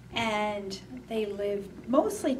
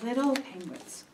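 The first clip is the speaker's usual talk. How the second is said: put-on reading to children; slower than usual. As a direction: read gently to little ones. Reads a picture book aloud.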